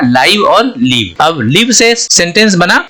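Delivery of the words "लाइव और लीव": "लाइव" with long i, then "लीव" with long ee, slowly; slow contrast of "लाइव" and "लीव"; two pronunciations. Shown as a demonstration. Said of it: "दो pronunciation हो गया".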